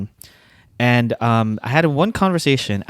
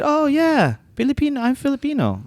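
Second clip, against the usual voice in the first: put-on high-pitched, eager Filipino-accented English. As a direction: imitate an excited Filipino-accented reply. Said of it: "but all accented".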